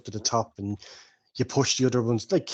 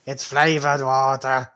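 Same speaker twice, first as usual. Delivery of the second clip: louder, slower barmaid retort; barmaid defending; 5 dB louder, slower than usual. Acted. Barmaid retorting to the old woman. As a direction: insist on it, put out.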